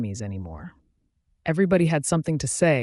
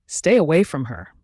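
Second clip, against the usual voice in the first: louder growl; 2 semitones higher, 6 dB louder than usual. A narrator's reading. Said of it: "I growled louder".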